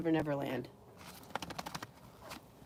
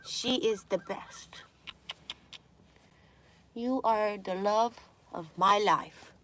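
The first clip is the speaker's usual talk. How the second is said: tender; 5 dB quieter, slower than usual. Real, not acted. Tender to her dog.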